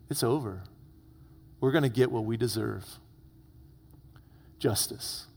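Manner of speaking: low, hushed defeat; resigned. Acted. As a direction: accept your doom quietly. Brothers resigned to punishment, acted.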